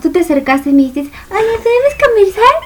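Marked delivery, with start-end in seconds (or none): 1.3-2.5 high, put-on little voice; voz fingida. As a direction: mimic her shy request in a squeaky voice. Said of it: "todo así como fije su voz". (vocecita fingida y aguda, en burla)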